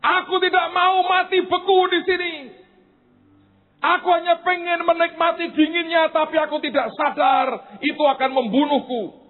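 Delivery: high, loud desperate plea; crying out to God. Acted. Bird cries to God for rescue, desperate shout. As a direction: beg God at the top of the voice. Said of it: "dia teriak ke Tuhan".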